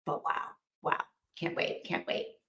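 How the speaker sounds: whispered mock enthusiasm, dry; a deadpan whispered "can't wait"; sarcastic whisper. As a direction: hush it out like a sarcastic aside. Mock enthusiasm for dull books.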